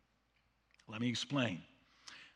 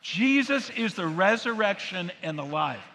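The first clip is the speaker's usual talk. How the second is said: loud, ringing declaration once more; loud proclaiming; 6 dB louder than usual. Real, not acted. Preacher repeating the creed with the crowd, loud proclamation. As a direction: call it out together again. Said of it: "One more time".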